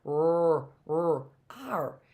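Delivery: "R" voiced as a held rrr; R sound. Shown as a demonstration. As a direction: sustain "R" as its phonic sound, then name it. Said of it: "I hear the sound what?".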